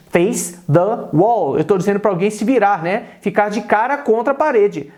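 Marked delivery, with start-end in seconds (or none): 0.1-1.6 "Face the wall." again very slowly, stress on wall; teacher repeats for students, very slow. Shown as a demonstration.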